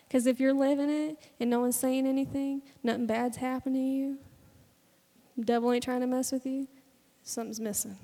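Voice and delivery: ordinary delivery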